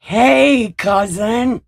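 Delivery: high, loud hail to a relative; big hollered hello; loud excited greeting. Real, not acted. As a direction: call out in happy surprise. Greets cousin joining the stream.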